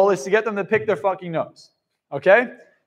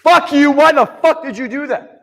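angry outburst; 5 semitones higher, 6 dB louder than usual. Shown as a demonstration. Showing the wrong way to react, an angry yell. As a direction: demo of a louder, higher furious snap. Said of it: "react with anger".

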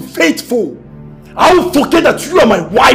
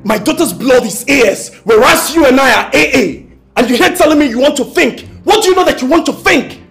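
angry confrontation; 4 semitones higher than usual. Acted. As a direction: demand the truth from his wife. Husband confronting wife over blood test.

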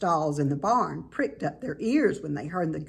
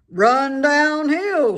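loud, slow cow call; lowing slow call; cow suggesting; 8 semitones higher, 8 dB louder, much slower than usual. A narrator's reading. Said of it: "suggested the cows".

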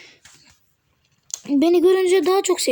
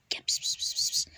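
whispered "pıs pıs" to lure a cat; quiet voiceless coaxing call; 11 dB quieter than usual. Pretend. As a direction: hiss softly like coaxing a stray. Calling the cat again.

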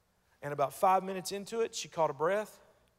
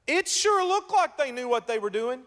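louder, higher preacher's challenge; preaching rising; 7 semitones higher, 7 dB louder than usual. Acted. Preacher rouses the congregation, higher and louder. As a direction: lift the room with this line.

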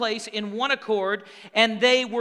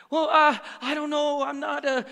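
higher, halting put-on doubter; mock hesitant excuse; 4 semitones higher than usual. Preacher imitating reluctant believers, mock hesitation. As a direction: stall on a raised, unsure voice.

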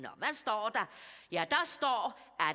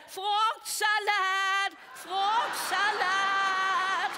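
high, long drawn-out comic scream; 9 semitones higher, 6 dB louder, much slower than usual. Acted. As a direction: scream it out loud, stretching every syllable. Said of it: "så skreg jeg".

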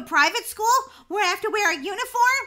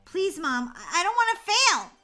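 desperate whine; 2 semitones higher, 4 dB louder than usual. Acted. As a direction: whine it out as a plea. Begs mother about failing.

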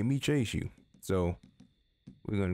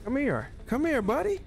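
coaxing horse call. Real, not acted. Calling the horse over.

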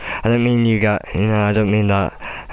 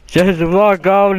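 laughing; 8 semitones higher, 3 dB louder, slower than usual. Real, not acted. Fleeing the witch, laughing.

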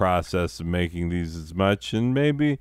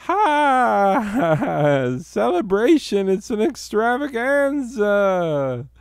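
hammed-up cheer; 6 dB louder, slower than usual. Mock cheer after sad news.

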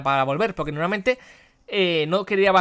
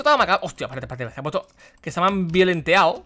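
ordinary talk

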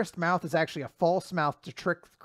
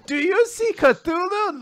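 high, slow singsong question; kids' show host parody; 12 semitones higher, 4 dB louder, slower than usual. Acted. Dora asking viewers to find Cthulhu, high singsong. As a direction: prompt the toddlers, hammed-up.

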